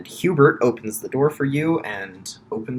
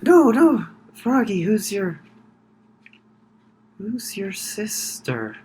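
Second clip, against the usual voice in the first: put-on drunk voice; 8 semitones higher, slower than usual. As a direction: nag him like a tipsy guest. Drunk character pesters again.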